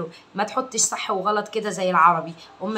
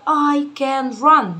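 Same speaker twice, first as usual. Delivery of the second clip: slow word by word; 4 semitones higher, 5 dB louder, much slower than usual. Shown as a demonstration. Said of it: "كل كلمه بقولها بترجمها".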